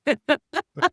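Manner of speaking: sudden high loud laugh; high burst of laughter. Real, not acted. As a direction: let out a bright squeal of laughter. Laughing at husband's reply.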